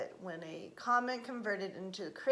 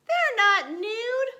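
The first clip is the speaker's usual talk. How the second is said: high, loud, drawn-out protest; little girl contradicting; 12 semitones higher, 12 dB louder, slower than usual. A narrator's reading. Kitten girl corrects her sister, high loud protest.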